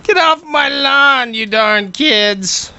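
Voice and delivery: put-on slow, high cranky old-timer; old man voice. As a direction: play a cranky old prospector yelling. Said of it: "I look like I'm eighty years old".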